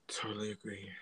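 soft unvoiced whisper. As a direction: breathe it out as a whisper. Echoed agreement, whispered.

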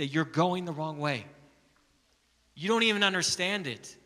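loud, rising, impassioned preaching; preaching at full force. Acted. Pastor urging his church to share the gospel, preaching at full force. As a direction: drive it home to the congregation.

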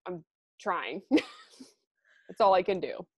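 ordinary talk, nothing marked

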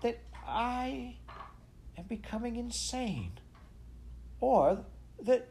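high, halting, drawn-out musing; slow, bewildered pondering with long pauses. A narrator's reading. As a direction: confess it slowly in a high voice. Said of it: "he said slowly".

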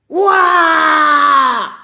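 long, high, very loud scream; bloodcurdling scream. Acted. Yeti frightened by the television, bloodcurdling scream. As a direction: scream full out, sliding slowly down. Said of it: "Ein markerschütternder Schrei".

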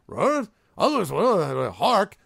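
high, loud goblin jabber; goblin chatter. Acted. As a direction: chatter back in goblin gibberish. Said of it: "they sort of chatter back".

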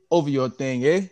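mock Canadian gangster slang; lightly voiced Toronto impression. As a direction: playfully put on a rapper's Toronto talk. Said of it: "I'm gonna do a Drake deal".

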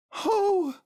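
loud, very high exclamation; Pooh. A narrator's reading. Pooh seeing his pencil case, high delighted cry. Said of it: "said Pooh".

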